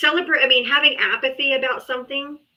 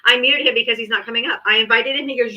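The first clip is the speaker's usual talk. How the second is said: normal talking voice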